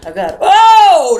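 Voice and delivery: sudden high loud yelp of surprise; startled. Real, not acted. Spotting a big fly mid-sentence, startled loud yelp. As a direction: jump at the sight of a fly.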